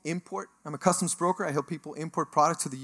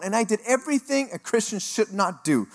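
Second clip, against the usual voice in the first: ordinary talk, nothing marked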